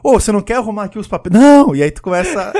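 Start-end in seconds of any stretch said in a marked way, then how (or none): 0.0-1.3 fast, higher, louder pestering; quick mock coworker voice; annoying office person. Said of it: "aquela pessoa chata do escritório". 1.3-1.8 very loud, high mock yell; hammed-up explosive refusal; shouted refusal. Acted refusal in the game.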